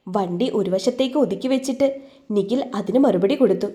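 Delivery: usual speaking voice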